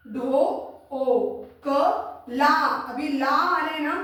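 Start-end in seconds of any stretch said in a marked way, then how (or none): 0.1-2.5 ढो, ओ, क, ला spaced with pauses; sound by sound. Shown as a demonstration. Teacher breaking a word up, ढो, ओ, क, ला separated. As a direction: spell ढो, ओ, क, ला out one sound at a time. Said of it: "अगर बोले ढोकला लिखो".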